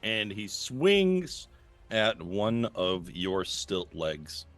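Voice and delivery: ordinary delivery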